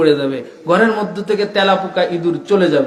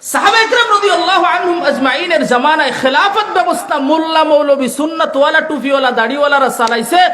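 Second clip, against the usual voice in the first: high, forceful oratory with stretched key words; impassioned preaching; 8 semitones higher, 4 dB louder than usual. Real, not acted. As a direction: thunder it out to the crowd in rising waves. (জোরালো চড়া গলায় ওয়াজ)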